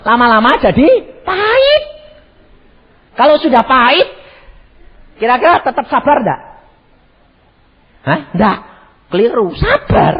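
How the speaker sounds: loud, high preaching call; fiery sermon voice. Acted. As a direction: preach it out to the hall. Preacher rising intensity.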